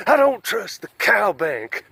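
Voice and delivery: high, loud and slow codger; old Pappy voice. Acted. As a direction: grumble like a suspicious old hillbilly. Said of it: "like old Pappy".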